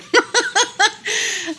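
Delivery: high loud giggle; burst of laughter. Real, not acted. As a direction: crack up laughing. Laughing at own find.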